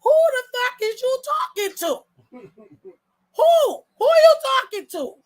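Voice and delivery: high, loud shouting, rising to a yell; furious shout. Retold or imitated. Host turning on a disrespectful son, yelling high and loud. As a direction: scream at him in disbelief. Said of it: "I have to turn around and be like".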